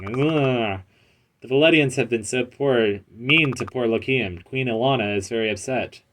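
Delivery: normal talking voice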